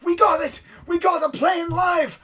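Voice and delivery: high, loud shout of triumph; cheering yell. Acted. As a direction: scream it out like cheering soldiers. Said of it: "cheering and screaming and yelling".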